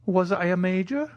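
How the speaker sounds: put-on high, tentative old soldier; timid. A narrator's reading. Old soldier unsure of his own rank, timidly. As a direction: ask it timidly, pitched high. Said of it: "timidly asked the old soldier".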